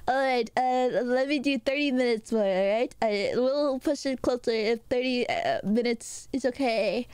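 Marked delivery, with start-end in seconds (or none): none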